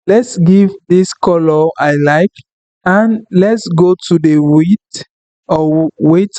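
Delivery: normal talking voice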